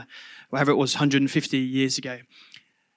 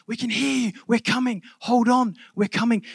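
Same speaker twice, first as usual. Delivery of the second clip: mock shouting; 7 semitones higher than usual. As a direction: call to a trapped miner, pitched up. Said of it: "these voices start shouting".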